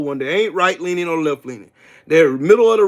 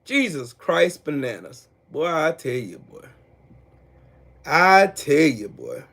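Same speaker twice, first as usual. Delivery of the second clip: usual speaking voice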